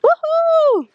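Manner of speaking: high, loud cry of relief, then gleeful; joyful whoop. Real, not acted. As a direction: celebrate getting out at last. Celebrating getting out of the bush.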